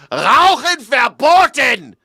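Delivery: high, slow, loud mock German command; German exclamation. Host imitating a German no-smoking sign, shouted command. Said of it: "like, an exclamation point".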